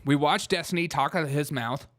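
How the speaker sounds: ordinary delivery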